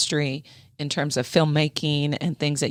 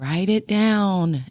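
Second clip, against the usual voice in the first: slow insistent repeat; 3 semitones higher, much slower than usual. Retold or imitated. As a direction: repeat it slowly and firmly. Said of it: "we always kept saying".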